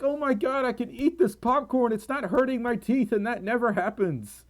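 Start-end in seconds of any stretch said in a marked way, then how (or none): 0.0-3.1 high-pitched, delighted gushing; retold happy amazement. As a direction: relive the happy surprise, pitch right up. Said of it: "it made me so happy".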